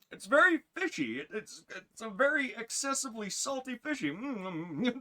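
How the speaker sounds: high-pitched, polite tasting act; mock polite reviewer. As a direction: praise a bad snack in a high voice. Imitates polite reviewer tasting bad snack.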